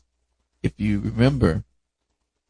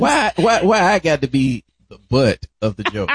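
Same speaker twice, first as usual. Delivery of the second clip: high, loud playful protest; mock complaint; 5 semitones higher, 5 dB louder than usual. The teased one objects to the storyteller, mock complaint. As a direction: protest being teased, pitched up at the start.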